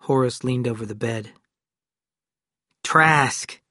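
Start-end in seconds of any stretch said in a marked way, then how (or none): 2.8-3.6 a loud, sharp call of the name; loudly. A narrator's reading. Deputy rousing the wounded man, loud call. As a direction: call him out firmly. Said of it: "he said loudly".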